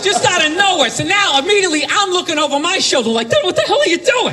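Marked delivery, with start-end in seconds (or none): 3.3-4.3 high, laughing "what are you doing"; alarmed disbelief. Retold or imitated. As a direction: pitch it up in shocked disbelief. Alarmed at a stranger's slur.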